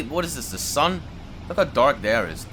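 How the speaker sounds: normal talking voice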